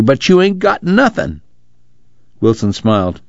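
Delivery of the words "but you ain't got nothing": high, excited outburst of disbelief. A narrator's reading. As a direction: blurt it out, suddenly much higher. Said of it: "Pa said excitedly".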